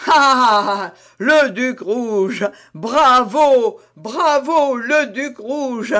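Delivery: loud, relishing cheer, savouring each word slowly; clap-along glee, drawing out le duc rouge; delighted approval. A narrator's reading. Porthos cheers Aramis's joke, loud and delighted. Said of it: "en battant des mains et en approuvant".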